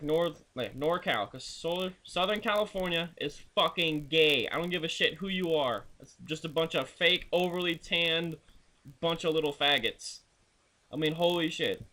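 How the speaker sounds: usual speaking voice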